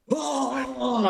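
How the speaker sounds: long, high-pitched "oh"; hammed-up sustained moan; mock distressed wail. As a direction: hold a high cry of mock panic. Acted patient trying to cope.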